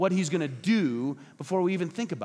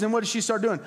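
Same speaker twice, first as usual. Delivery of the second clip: ordinary delivery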